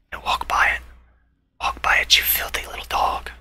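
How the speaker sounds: whispering insults at a target; sneaky whisper. Real, not acted. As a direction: breathe it while stalking prey. Whispering at unaware enemy.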